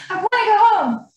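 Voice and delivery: imitated high, pleading cry; Lance. Wife voicing husband who wants to go home, imitated tearful plea. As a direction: plead like a tearful young child. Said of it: "he's just crying. He's crying".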